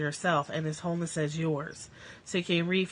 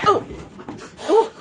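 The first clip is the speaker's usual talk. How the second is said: sudden high, loud cry of fright; startled yelp; 14 semitones higher, 11 dB louder than usual. Real, not acted. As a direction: jump at a sudden noise. Startled by fighting cats.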